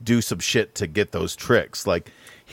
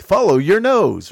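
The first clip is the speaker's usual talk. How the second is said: plain Midwestern mascot slogan, pitched up; bland Midwestern accent; 7 semitones higher, 4 dB louder than usual. Shown as a demonstration. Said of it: "bland Midwestern".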